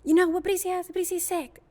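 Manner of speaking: high, loud scolding mom imitation; mad mother. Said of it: "she was getting mad at me".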